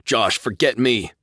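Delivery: ordering. A narrator's reading. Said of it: "Nathan ordered".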